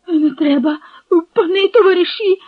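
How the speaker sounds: high-pitched frightened whine; whining plea. A narrator's reading. Captive begs not to go to the forge, whining. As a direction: beg in a whining, lifted voice. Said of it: "заскиглив Копил".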